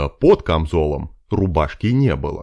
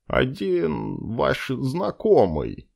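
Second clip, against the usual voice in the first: soft elf voice; 6 semitones higher, 3 dB quieter, much slower than usual. Acted. Elf answers slyly.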